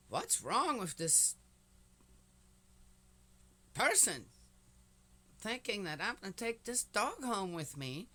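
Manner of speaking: thinking this can't be serious; incredulous. Retold or imitated. Retold thought, disbelief.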